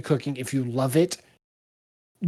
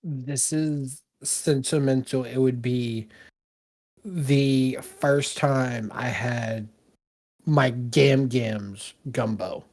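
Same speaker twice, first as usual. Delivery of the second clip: sentimental; 4 semitones lower, 6 dB quieter, much slower than usual. Real, not acted. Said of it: "he's having a Ratatouille moment".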